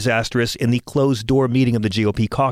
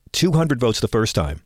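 ordinary talk

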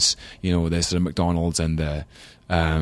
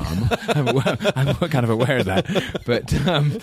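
high, laughing embarrassed words; 7 semitones higher, slower than usual. Real, not acted. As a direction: laugh it off nervously. Laughing at own awkwardness.

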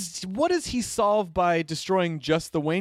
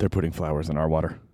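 low conspiracy voice; 11 semitones lower than usual. Acted. Paranoid quote, low voice.

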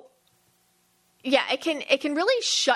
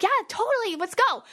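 eager yes; 11 semitones higher than usual. Retold or imitated. Eager yes to birthday plan.

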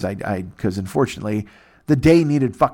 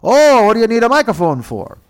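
mock suspicious agent; 10 semitones higher, 7 dB louder than usual.

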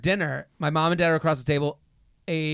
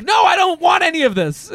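high, exasperated outburst; retold high-pitched protest; mock protest; 10 semitones higher, 3 dB louder than usual. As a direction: cry out in comic refusal. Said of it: "And I'm like".

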